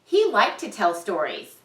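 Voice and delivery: plain reading voice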